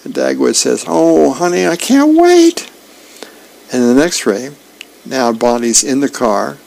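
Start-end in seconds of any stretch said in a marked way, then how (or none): 0.9-2.7 gushing with delight, slowing at the end; giddy excited squeal. Acted. Dagwood excited about dessert.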